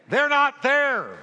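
loud and slow, every word punched; emphatic preaching. Real, not acted. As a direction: hammer it home slowly.

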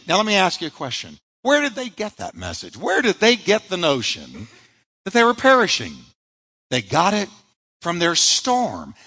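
ordinary talk

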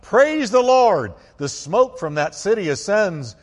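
high, loud proclamation; ringing shout of praise. Acted. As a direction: let the praise ring out at full voice. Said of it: "their voices rang out".

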